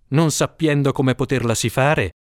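ordinary delivery, nothing marked

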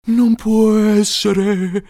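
high, drawn-out exclamation; crying out. A narrator's reading. Ghost father disbelieving the arrival, crying out. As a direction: cry it out high and slow. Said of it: "gridò Gonnellino svolazzante".

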